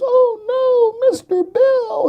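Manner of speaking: high squeaky mock wail; Mister Bill cry. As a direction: squeal it like a cartoon victim. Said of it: "And then it says".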